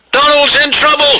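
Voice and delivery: high, urgent announcement; urgent alarm. A narrator's reading. Duck brings Douglas bad news, urgent.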